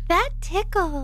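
high, eerie toy voice; creepy small child voice. Retold or imitated. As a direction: play the haunted gadget. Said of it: "It sounds like a small child".

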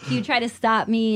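ordinary talk, nothing marked

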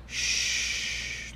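long, hushed "shh"; soft shush. Shown as a demonstration. Comic describing the calmer house, soft shush. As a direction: shush softly to show calm.

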